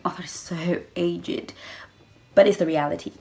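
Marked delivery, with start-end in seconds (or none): 0.0-1.5 mock dismissive whisper. Dismissive thought, eye roll.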